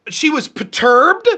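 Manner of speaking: high, slow, mocking suggestions; drawn-out sarcastic guessing, pitched high; sarcastic word offers. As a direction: dangle each word at the opponent, slow and high. Sarcastically offering words for her mood.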